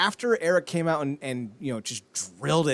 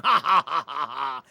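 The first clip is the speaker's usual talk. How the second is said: mock villain laugh. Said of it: "standing over the twelve-year-old going".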